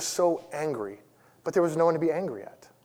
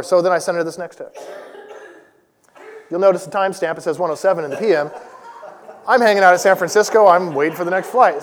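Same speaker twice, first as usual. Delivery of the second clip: ordinary talk, nothing marked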